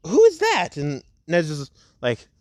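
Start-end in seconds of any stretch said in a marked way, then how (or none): 0.1-0.8 very high-pitched curious outburst; acted bewilderment, pitched way up; startled question. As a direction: squeak it out in surprise. Acted character asks, startled.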